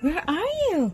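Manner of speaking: high sing-song call; high-pitched pet talk. Real, not acted. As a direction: coo as if searching for a kitty. Calling for the cat.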